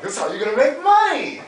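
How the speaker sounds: high rising mock voice; mocking upspeak. Shown as a demonstration. Coach shows how he never says it, high. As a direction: parody the weak upstroke. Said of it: "I'm never like".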